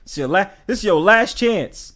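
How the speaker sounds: a streamer's hammed-up last warning; mock stern warning. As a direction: lay down the law, half joking. Warning a chatter, last chance.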